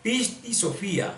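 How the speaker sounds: slow "Pisti Sofía" with stress on FÍ; demonstrated pronunciation. Shown as a demonstration. Teacher showing how the translator says it, slow and careful. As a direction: draw out "Pisti Sofía", accent on the final í. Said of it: "que se pronuncia así".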